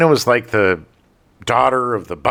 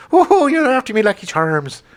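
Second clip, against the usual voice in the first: put-on hammy stage Irish accent, pitched way up; bad Irish accent; 13 semitones higher than usual. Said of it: "with a really bad Irish accent".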